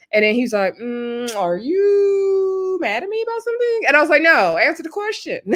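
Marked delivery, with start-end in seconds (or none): 0.8-3.8 high, slow, whimpering mock plea; imitating Austin's evasive whine, high and drawn out; mock whiny dodge. Austin dodging, mock whining. 4.2-5.4 demanding. Retold or imitated. Speaker demanding he answer.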